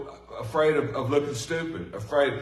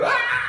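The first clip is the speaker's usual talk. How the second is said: mock scream; 5 dB louder than usual. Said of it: "disciples were screaming and saying".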